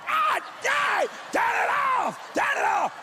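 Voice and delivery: high panicked yell; scalded shriek. Retold or imitated. Scalded husband yelling at his wife to turn the water off. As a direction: scream it like you're being scalded.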